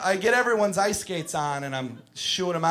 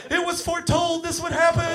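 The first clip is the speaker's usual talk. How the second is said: high, mock-solemn child prophecy; solemn kid; 11 semitones higher than usual. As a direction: pronounce it like a doom-laden oracle. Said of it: "He's like".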